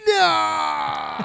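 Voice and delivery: put-on high, loud toddler shout of No; toddler refusal. Dad imitating his son's tantrum shouting no. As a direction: scream no like a furious two-year-old. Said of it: "And he's like".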